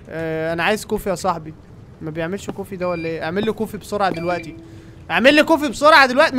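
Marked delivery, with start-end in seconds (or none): 5.1-6.4 impatient demand. Real, not acted. Demanding coffee from machine.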